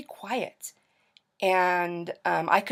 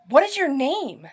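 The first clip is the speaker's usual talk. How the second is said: higher, louder imitated question; retold sudden realization; 8 semitones higher, 5 dB louder than usual. Birth mother asks caller's name, acted sudden realization. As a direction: act out her sudden, emphatic question.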